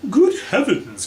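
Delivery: high, loud alarmed cry. Acted. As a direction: burst out in alarm. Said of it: "cried Mister Body".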